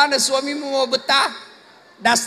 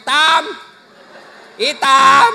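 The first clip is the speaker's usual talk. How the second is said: blunt call; 7 dB louder, much slower than usual. Retold or imitated. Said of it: "nak panggil".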